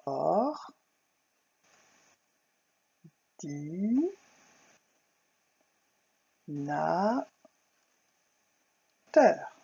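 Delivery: "Or, di, na, teur" split with long pauses; syllable by syllable. Shown as a demonstration. Teacher to pupils, spelling out syllables slowly. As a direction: cut "ordinateur" into slow syllables. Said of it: "Un ordinateur".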